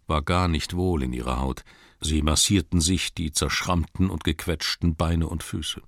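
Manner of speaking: plain reading voice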